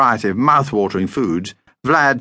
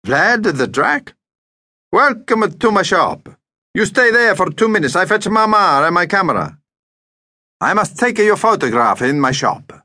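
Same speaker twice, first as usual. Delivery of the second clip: put-on high, effusive Greek-accented welcome; delighted Greek shopkeeper; 9 semitones higher than usual. Deli owner welcomes a famous vampire, delighted. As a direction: gush like a starstruck Greek shop owner.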